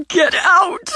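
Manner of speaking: high, loud, anguished scream of Get out!; screamed order. Acted. Simone screaming at her sister to leave, high and loud. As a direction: let it explode into a cry.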